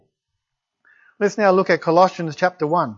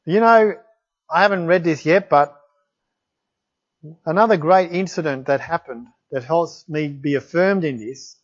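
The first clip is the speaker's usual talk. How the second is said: ordinary delivery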